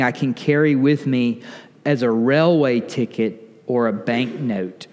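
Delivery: slow, measured quote reading; deliberate recitation, dropping low at the close. Real, not acted. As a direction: read it out slowly, phrase by phrase. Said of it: "I'm gonna read it slowly".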